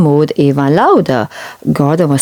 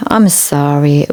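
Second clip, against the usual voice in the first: plain reading voice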